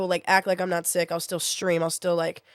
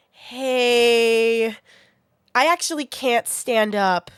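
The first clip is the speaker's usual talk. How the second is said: drawn-out, raised greeting into slow admission; awkward confession; 4 semitones higher, 6 dB louder, much slower than usual. Retold or imitated. Texting the ride to cancel, drawn-out awkward confession. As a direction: stretch the hello high and loud, then confess slowly. Acts out apologetic text to Brandon.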